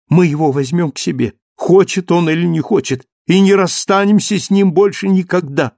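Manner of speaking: high, loud, rushing explanation; agitated excitement. Acted. Marius explaining Valjean's rescue to Cosette, agitated. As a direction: tumble out the revelation.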